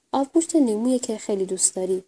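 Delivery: usual speaking voice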